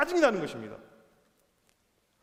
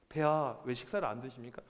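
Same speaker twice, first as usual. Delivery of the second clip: lower, softer courtly voice; deferential coaxing; 8 semitones lower, 6 dB quieter than usual. Acted. Queen asking sulking king why he won't eat, deferential coaxing. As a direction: address royalty gently and formally.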